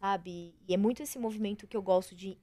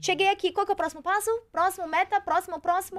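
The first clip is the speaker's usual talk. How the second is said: high, urgent run of questions; retold restless overachiever, pitched well up; impatient striving; 9 semitones higher, 4 dB louder than usual. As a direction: act out chasing the next goal without stopping. Acting her driven former self.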